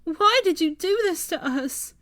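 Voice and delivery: high, loud anguished shout. A narrator's reading. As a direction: scream the question skyward. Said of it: "she suddenly shouted".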